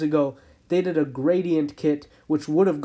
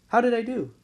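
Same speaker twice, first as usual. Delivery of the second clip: pitched higher, fishing for praise; asking again, higher; 6 semitones higher, faster than usual. Real, not acted. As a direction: repeat the question pitched higher, playful. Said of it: "just a little dad joke there".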